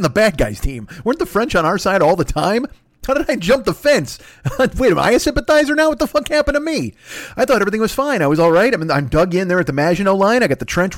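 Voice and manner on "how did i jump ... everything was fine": rising panic. Real, not acted. To listeners, wondering if he switched sides, rising mock panic. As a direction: voice climbing higher in mock alarm. Panicked self-questioning, pitch climbing.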